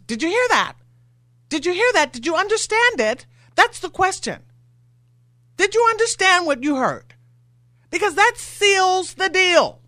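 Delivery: high, insistent, all fired up; hammering home the point to listeners; worked-up rhetorical questions. Real, not acted. Radio host to listeners, worked up and high-pitched.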